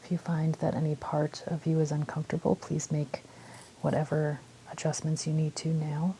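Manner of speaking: calm meditation guide with long pauses; unhurried phrases, leaving space to breathe; guided meditation. Real, not acted. Guiding listeners through a body scan, calm meditation voice.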